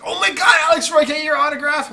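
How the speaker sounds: high, loud starstruck gush; excited fan. Retold or imitated. Fan recognizes him excitedly.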